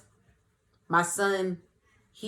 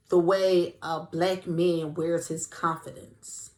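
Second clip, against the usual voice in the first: normal talking voice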